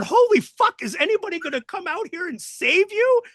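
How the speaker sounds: very high, yelled disbelief; shocked disbelief. Retold or imitated. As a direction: gasp at the endless beating. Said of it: "we're sitting there going".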